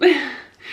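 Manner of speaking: a breaking laugh through tears; teary laugh. Real, not acted. Telling her story on camera, teary broken laugh. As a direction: laugh shakily while starting to cry. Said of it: "Sorry, I'm already crying".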